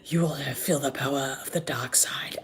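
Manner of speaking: low, hushed dark lord voice; lightly voiced menacing villain, whispered; sinister whisper. Villain threatening with the dark side, low whisper.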